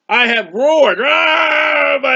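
loud preacher's roar of anguish; psalm read as a loud cry of distress; roaring in pain. Retold or imitated. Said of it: "He is screaming in pain".

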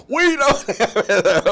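high, drawn-out mock yell; very loud. Wife yelling about missing passports down the phone, very loud.

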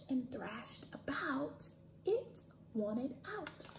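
soft hushed whisper; storybook whisper. Acted. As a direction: drop to a secret whisper. Whispered end of verse.

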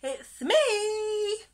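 very high, loud held call. A narrator's reading. Little fish greeting his friends, high loud call. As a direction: sing it out loudly to be noticed. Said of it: "he called".

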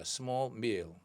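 ordinary talk, nothing marked

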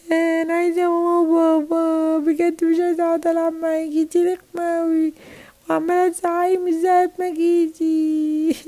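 high sing-song whining cry; child crying. Acted. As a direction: keep up a long toddler's wail. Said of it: "بطلي عياط". (زن وعياط طفلة صغيرة)

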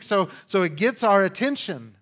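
ordinary talk, nothing marked

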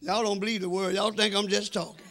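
ordinary talk

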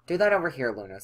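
ordinary talk, nothing marked

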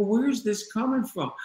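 high-pitched, retold hurt question; tearful. Husband presses his frightened wife for a reason, retold tearful. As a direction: ask it high, imitating near tears. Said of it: "I had tears in my eyes".

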